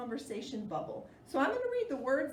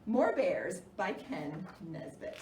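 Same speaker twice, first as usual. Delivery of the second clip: usual speaking voice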